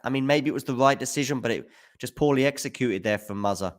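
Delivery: ordinary talk, nothing marked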